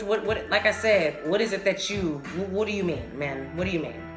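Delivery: normal talking voice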